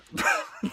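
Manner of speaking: squeaky high laugh; shrill amused laugh; high burst of laughter. Real, not acted. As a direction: let out a sudden high laugh. Laughing at burning house.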